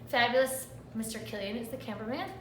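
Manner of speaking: ordinary talk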